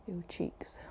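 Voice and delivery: soft whispered cue. Acted. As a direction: whisper it gently.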